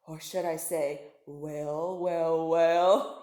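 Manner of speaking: a hungry shark purring to himself; sly shark thinking. Acted. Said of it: "he thought".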